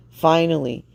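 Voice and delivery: usual speaking voice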